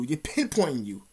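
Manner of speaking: ordinary talk, nothing marked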